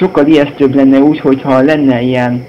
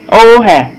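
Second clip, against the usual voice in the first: sudden high, loud yelp of surprise; startled yelp; 8 semitones higher, 5 dB louder than usual. Real, not acted. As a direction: caught off guard, yelp it out. Jump scare during horror play. (ijedt felkiáltás)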